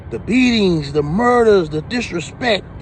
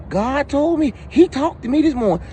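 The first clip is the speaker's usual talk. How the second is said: higher, mocking pious voice; mock believer; 7 semitones higher than usual. Mocking preachers who claim God spoke to them, higher voice. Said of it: "stop using that".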